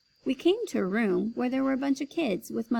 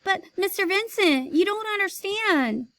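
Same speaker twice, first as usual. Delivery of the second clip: higher, urgent call; calling after him; 10 semitones higher, 5 dB louder than usual. Acted. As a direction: chase him with the words. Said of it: "She called".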